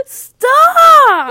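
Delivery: very high, very loud, drawn-out shout; exasperated scream. Real, not acted. Teen at the end of patience with sibling, screaming. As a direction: scream it with all your might.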